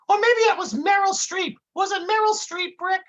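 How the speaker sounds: high, dim-witted sidekick explaining things; dopey Dummerton voice. Acted. Dummerton lecturing his partner Brick onstage, high dopey voice.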